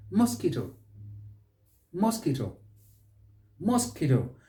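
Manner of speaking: "Mosquito" as mos-KEE-to; plain k in "Mosquito", no w; correct mosquito. Shown as a demonstration. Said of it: "Stress is on that second syllable".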